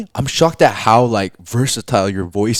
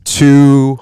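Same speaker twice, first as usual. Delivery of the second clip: ordinary talk, nothing marked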